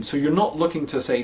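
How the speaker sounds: normal talking voice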